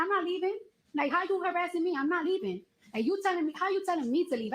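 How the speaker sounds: high-pitched indignant protest, not shouted; retold screaming argument. Patient refusing to leave the building, indignant retold screaming. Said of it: "we're literally screaming at each other".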